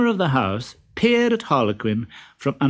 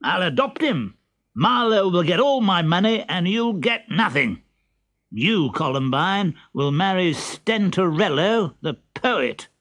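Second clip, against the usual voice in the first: put-on raised pitch, stern old father; 7 semitones higher than usual. Pantalone's speech, same voice.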